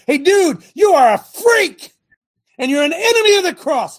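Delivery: full-force shouted rebuke; furious shout. Acted. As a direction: roar the condemnation. Preacher denouncing an enemy.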